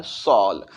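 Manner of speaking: सॉल eased out as "sawl"; gentle "aw" sound. Shown as a demonstration. Teacher asking for softness, gentle "sawl". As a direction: soften सॉल with rounded "aw". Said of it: "प्यार से थोड़ा softness के साथ".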